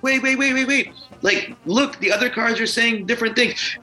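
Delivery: high, urgent plea to calm someone; re-enacted alarm, hastily talking someone down; panicked backpedalling. Acted panic at friend crying over card.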